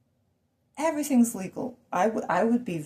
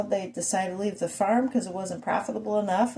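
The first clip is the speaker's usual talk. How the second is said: ordinary talk, nothing marked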